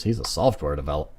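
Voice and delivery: ordinary talk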